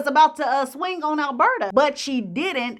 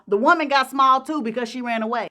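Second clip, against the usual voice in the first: usual speaking voice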